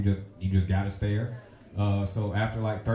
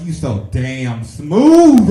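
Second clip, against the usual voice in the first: very loud, slow, swaggering praise; cocky self-praise; 4 semitones higher, 15 dB louder, much slower than usual. Retold or imitated. His heart praising the blind comic's smooth move, loud swagger. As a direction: hype yourself up like a smooth talker. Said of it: "in my heart, I was like".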